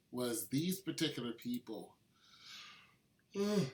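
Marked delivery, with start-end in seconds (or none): 3.3-3.7 words stretched by a yawn. Real, not acted. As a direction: say it mid-yawn. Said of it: "I yawned".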